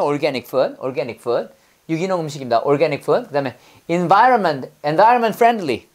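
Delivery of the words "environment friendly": ordinary talk, nothing marked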